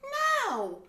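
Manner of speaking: high, loud outraged protest; exasperated shriek. Retold or imitated. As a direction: yell at the phone in disbelief. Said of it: "I'm like".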